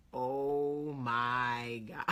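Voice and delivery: low, drawn-out, mock astonishment; stunned recognition. Old friend recognizes the caller, retold low, slow astonishment. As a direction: draw it out slowly, imitating his disbelief.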